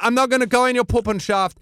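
high camp Austrian reassurance. Retold or imitated. Bruno persuading the wrestler, high camp reassurance. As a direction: coax in a high Austrian accent.

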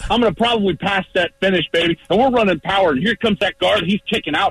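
high, pumped-up play call; live play-by-play. Acted. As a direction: narrate the play like you're in it. Acted play-by-play of a run play.

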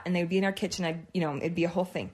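ordinary delivery

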